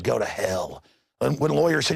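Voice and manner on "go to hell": low, dismissive sneer; mocking the TSA's dismissal; sneering TSA reply. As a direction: brush them off with contempt. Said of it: "the T S A said".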